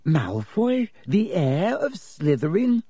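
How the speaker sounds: put-on incredulous girl's voice; skeptical. A narrator's reading. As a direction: draw it out in disbelief, rising. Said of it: "said Hermione skeptically".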